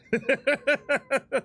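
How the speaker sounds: high shaky relieved laugh; nervous laugh. Real, not acted. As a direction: laugh off the fright. After being scared.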